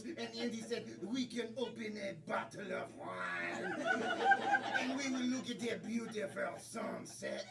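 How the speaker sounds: put-on low, soft French accent. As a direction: narrate as a low-voiced French romantic.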